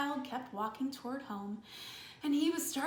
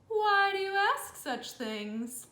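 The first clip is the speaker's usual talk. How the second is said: higher, slow, drawn-out suspicious question; sly crocodile; 7 semitones higher, 3 dB louder, slower than usual. Acted. Crocodile slyly questions the elephant child, slow and drawn out.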